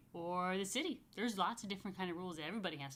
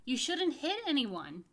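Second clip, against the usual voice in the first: put-on higher, slow, eager little girl; child's answer; 6 semitones higher, 5 dB louder than usual. Julie answering the teacher, bright and eager. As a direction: chirp it like a keen pupil. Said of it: "Julie answered".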